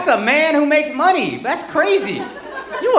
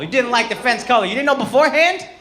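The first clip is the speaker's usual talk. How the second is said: ordinary talk, nothing marked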